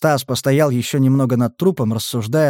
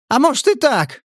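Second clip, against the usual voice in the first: tearful yell, high and raised; crying shout; 12 semitones higher than usual. Acted. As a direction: shout it through tears. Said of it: "сквозь слёзы выкрикнул". (выкрик сквозь слёзы)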